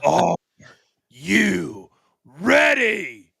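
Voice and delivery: stretched arena announcer's call; ring announcer. Retold or imitated. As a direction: hype the crowd like a ring announcer. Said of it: "Can I, can I reenact what that might look like".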